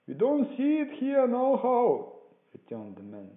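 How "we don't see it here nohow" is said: high, slow, loud holler; called-back reply. A narrator's reading. Man at the window answering the blind beggar, loud shout. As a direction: holler it down, drawing out the words.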